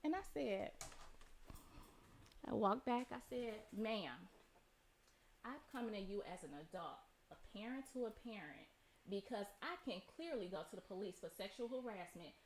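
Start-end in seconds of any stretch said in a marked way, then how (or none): 3.8-12.3 calm firm warning. Retold or imitated. Calm firm warning to other mother.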